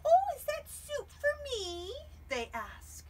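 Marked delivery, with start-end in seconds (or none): 0.0-1.9 much higher, louder cackly witch; high, loud witch asking for soup. Acted. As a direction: squeal it like an eager witch.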